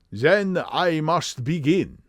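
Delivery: slow, high German pronouncement; measured and heavily German; strong German accent. Acted. Count agreeing to begin, slow German accent. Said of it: "a strongly marked German accent".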